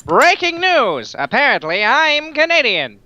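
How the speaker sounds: put-on high, slow old newsreel announcer; hammy old-timey newscaster; old-timey news reporter. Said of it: "old-timey news reporter".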